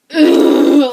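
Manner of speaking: very loud, drawn-out "ugh"; loud groan of total frustration; exasperated groan. Real, not acted. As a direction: let out a big disgusted groan. Groan mid rant.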